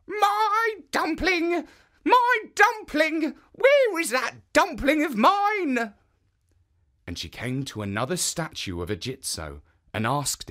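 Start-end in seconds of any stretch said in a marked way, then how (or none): 0.1-5.9 put-on high, slow old woman calling after her dumpling; old woman crying out. Old woman running down the road calling for her dumpling. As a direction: call out for the lost dumpling, high and drawn out.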